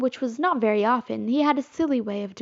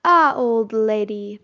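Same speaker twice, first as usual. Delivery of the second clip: slow hearty father voice; fatherly greeting; 6 dB louder, slower than usual. A narrator's reading. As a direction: greet her like a dad. Peter as father.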